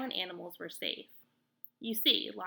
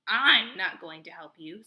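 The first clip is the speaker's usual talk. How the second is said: louder, sharp refusal; mild screech; 8 dB louder than usual. A narrator's reading. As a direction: call it down from the treetop. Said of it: "screeched the owl".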